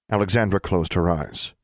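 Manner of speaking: ordinary delivery, nothing marked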